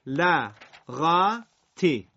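بلغتی split into slow separate syllables. Shown as a demonstration. Teacher spells out بلغتی for students to repeat, syllable by syllable. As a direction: break بلغتی apart, very slowly. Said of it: "سر هم بخونید".

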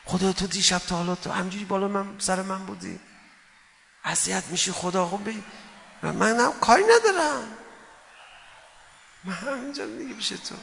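soft, intimate plea to God, voice held high; tender prayer to God. Acted. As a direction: whisper your heart to God like a child. (نجوای عاشقانه با خدا)

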